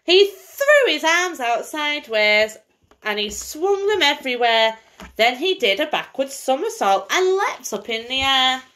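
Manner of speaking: lively bedtime story reading; singsong picture-book voice for a little girl; reading to a child. Acted. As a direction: read it like a storybook to a small child. Mother reads picture book aloud.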